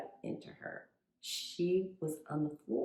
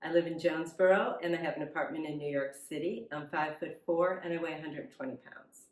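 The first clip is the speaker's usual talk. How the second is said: normal talking voice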